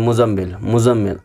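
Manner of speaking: मुज़म्मिल run quickly with a single short z; common mispronunciation. Shown as a demonstration. Teacher showing what people usually say, मुज़म्मिल with short consonants. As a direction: say मुज़म्मिल loosely, no doubled z, no held m. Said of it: "usually लोग क्या बोलते हैं".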